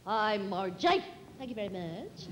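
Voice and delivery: deep, booming low voice; Orson Welles impression. Comic hostess greets Orson Welles at the party, deep booming. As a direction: rumble it out like a grand old actor. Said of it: "the wonderful Orson Welles".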